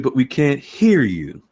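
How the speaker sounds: ordinary talk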